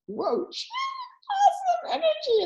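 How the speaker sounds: high, drawn-out mock amazement; amazed. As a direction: marvel in a stretched, high-pitched voice. Retelling amazement at saleswoman's energy.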